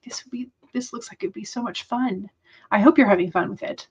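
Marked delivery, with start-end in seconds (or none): 0.0-2.3 whispering. Retold or imitated. Inner thought quoted wistfully.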